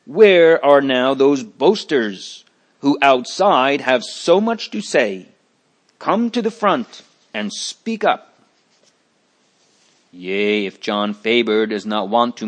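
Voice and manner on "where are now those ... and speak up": loud indignant call, pitch climbing high; crying out. Acted. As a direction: call out a challenge to the hall. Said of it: "one of those that are present cries out".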